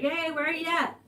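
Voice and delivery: mild mock call across the yard. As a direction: imitate someone calling for a person. Husband calls to her outside.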